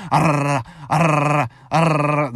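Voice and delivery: growling rumble of a shaking truck; imitated engine rattle. Showing viewers how hard the truck shook, growled rumble. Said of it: "when I'm talking about the truck was shaking, it was".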